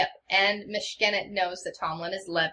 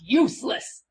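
loud, higher contemptuous bark; angry shout; 5 semitones higher, 5 dB louder than usual. Acted. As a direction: spit it out at the fool. Lord berates guard, whacks him.

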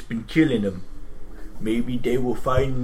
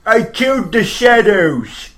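orc boast; 5 semitones higher, 7 dB louder, slower than usual. Acted. Orc player character boasting.

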